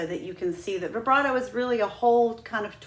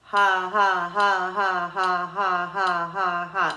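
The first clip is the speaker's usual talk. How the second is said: loud, slow breath pulses on ha; steady ha pulses at seventy-two; two pulses per click; 6 dB louder, much slower than usual. Shown as a demonstration. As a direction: push ha in time with the metronome. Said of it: "That's going to sound like this".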